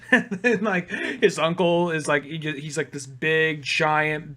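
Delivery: usual speaking voice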